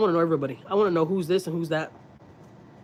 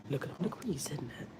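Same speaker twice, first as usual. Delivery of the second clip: whisper; 4 semitones lower, 13 dB quieter than usual. Real, not acted. Pointing out cat on camera.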